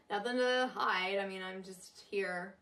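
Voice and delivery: Californian uptalk, lifted sentence ends; California accent. Shown as a demonstration. Coach showing the West Coast now thing, California uptalk. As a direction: bring phrase ends up like a Californian. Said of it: "bring it up at the ends of your sentences".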